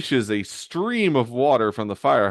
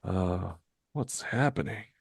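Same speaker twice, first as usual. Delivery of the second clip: soft, groggy question; hesitant, quiet confusion; coming to, dazed; 6 dB quieter than usual. Acted. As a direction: murmur it as if just waking. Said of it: "begins to regain his senses".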